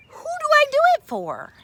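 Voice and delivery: fly up high in wonder; wondering question. Acted. Carl puzzling aloud to the fox, high and fast.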